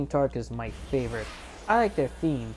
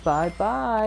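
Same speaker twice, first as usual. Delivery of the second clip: higher mock farewell; lightly acted cartoon goodbye; Big; 7 semitones higher than usual. Big sees Amy off, lightly voiced farewell. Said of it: "and Big's like".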